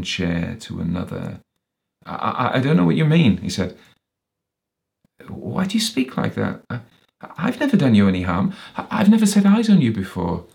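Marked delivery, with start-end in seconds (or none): 2.0-3.4 high, loud, stammering protest; raised voice tripping over the first word; alarmed stammer. Acted. Walter stammers before the menacing man. 5.3-10.5 high, quick, stammering denial; alarmed protest. Acted. Walter protests to the menacing man.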